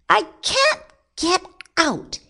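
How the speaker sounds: high, squeaky stuck-rabbit cry; mild shout. A narrator's reading. Rabbit stuck in the hole, high mild shout. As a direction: call out helplessly in a high voice. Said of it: "he shouted".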